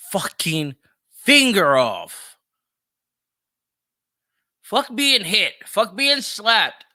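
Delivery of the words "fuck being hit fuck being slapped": ordinary talk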